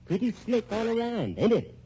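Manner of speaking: high, laughing smugness; gloating. Acted. Man gloating to Mary over his trap, smug laugh. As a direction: savor your win. Villain gloats after framing her.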